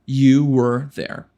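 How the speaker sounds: You were there bem lento e claro; devagar. Shown as a demonstration. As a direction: fale pausado, palavra por palavra. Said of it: "Como eu digo, você estava lá". (You were there, lento, para repetir)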